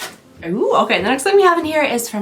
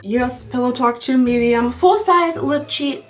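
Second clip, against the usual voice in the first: ordinary delivery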